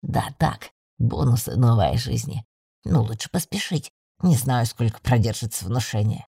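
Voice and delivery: low, offhand familiar voice; deep-voiced magical companion being casual. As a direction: shrug it off in a deep voice. Familiar shrugs off the question.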